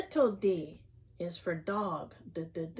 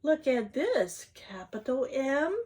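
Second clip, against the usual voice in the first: ordinary talk, nothing marked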